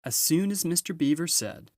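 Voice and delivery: ordinary delivery, nothing marked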